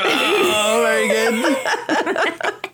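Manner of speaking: squeaky high, very drawn-out praise; high bat squeaks. Pretend. Bat impression requested.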